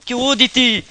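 very high, very loud shriek; panicked scream. Real, not acted. Panic sealing the hole.

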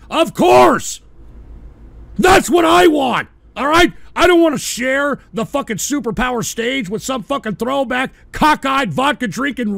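furious ranting at chat about superpowers; angry shouting rant. Real, not acted. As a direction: bellow your contempt at the rival powers.

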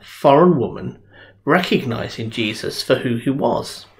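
ordinary delivery

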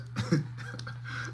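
real wheezing laughter; breathless laugh. Real, not acted. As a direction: laugh till the breath runs out. Cracking up over Marsha.

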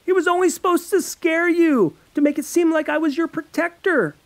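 high, loud pleading excuse; boy shouting his excuse at his furious friend; desperate shouting. Acted. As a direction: shout the excuse over her.

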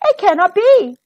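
high, loud disbelief; outraged cry. Acted. Stepmom sees the shoe fit, high loud outrage. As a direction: cry out in shock. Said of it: "Her stepmom cries".

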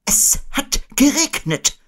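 very high, slow owl voice; Eule. A narrator's reading. Owl explaining the long words, very high and slow. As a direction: spell it out high and slowly. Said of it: "erläuterte Eule".

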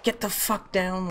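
high urgent call to get down; urgent shout. Real, not acted. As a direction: yell at him to take cover. Shouting at teammate to take cover.

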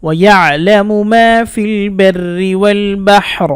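very slow, drawn-out chanted verse; Quran recitation. Shown as a demonstration. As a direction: recite the whole verse as a model, stretching the vowels. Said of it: "একসাথে পড়ে শোনাচ্ছি".

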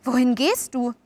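mildly anxious question; understated unease; anxious foreboding. A narrator's reading. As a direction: call out with a touch of worry. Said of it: "rief sie in banger Vorahnung".

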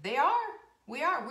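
high, loud and thrilled; delighted exclamation; excited. Real, not acted. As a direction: burst out with excitement. Excited sales news.

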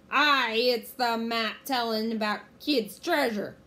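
loud, slow pirate voice; pirate captain. A narrator's reading. Captain Bones explaining his map, loud slow pirate. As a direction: declare it slowly and loudly.

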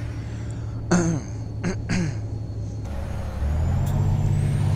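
long rough throat clear. Real, not acted. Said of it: "I'm losing my voice".

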